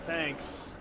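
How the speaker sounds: normal talking voice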